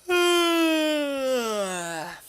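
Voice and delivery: long, high voiced sigh. Reading a visual novel aloud, long sigh. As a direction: let out a drawn-out groaning sigh. Sigh while voicing the game.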